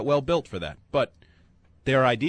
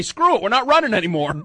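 mock Jets coach; 7 semitones higher, 6 dB louder than usual. Voicing the Jets giving up.

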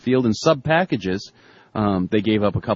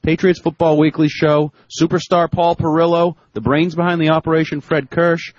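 upbeat, raised voice reading a fan email; email read aloud; 4 semitones higher than usual. Acted. Reads listener email.

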